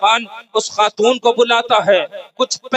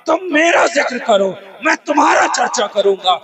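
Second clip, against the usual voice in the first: raised, higher preacher's cry; preaching at full force; 5 semitones higher than usual. Acted. Preacher proclaiming God's promise to listeners, ringing out. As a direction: drive the promise home to the crowd.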